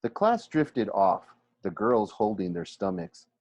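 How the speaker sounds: usual speaking voice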